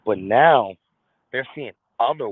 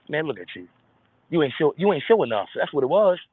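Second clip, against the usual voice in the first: ordinary delivery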